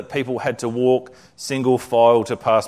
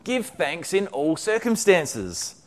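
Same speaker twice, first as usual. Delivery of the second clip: eager; 7 semitones higher than usual. A narrator's reading. Betsy eager revelation.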